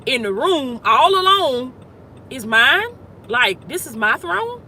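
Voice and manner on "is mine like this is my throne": normal talking voice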